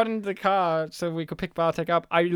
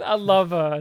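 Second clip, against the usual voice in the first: ordinary talk, nothing marked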